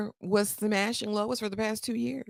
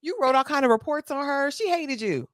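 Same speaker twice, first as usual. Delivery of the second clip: higher, louder, yelling at the unwelcome visitor; retold outburst; 8 semitones higher, 5 dB louder than usual. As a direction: lash out at the chief. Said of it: "Marshall's spazzing out, cussing everybody out".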